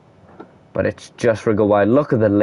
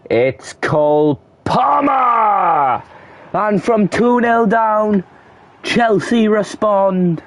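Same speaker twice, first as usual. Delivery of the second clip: goal celebration call; 9 semitones higher, slower than usual. Real, not acted. Commentator celebrating the equaliser from the spot, slow high jubilant call. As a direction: savour the goal, drawing every word out.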